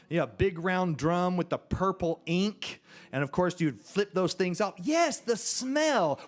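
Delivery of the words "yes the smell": slow, high, drawn-out relish; swooning, stretched-out exclamation; rapturous recall. Acted. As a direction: savour every word, pitched up high. Relishing remembered ditto smell, to audience.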